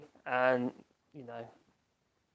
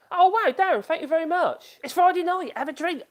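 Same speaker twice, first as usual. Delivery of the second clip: high, louder delighted gush; light up at the donation; thrilled thank you to Darren; excited thanks; 12 semitones higher, 5 dB louder than usual. Real, not acted. Thanking a super chat donor.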